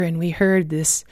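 usual speaking voice

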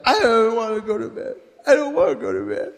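lightly voiced pouting daughter; whiny little girl. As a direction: play the tired little girl whining. Little daughter refusing bedtime.

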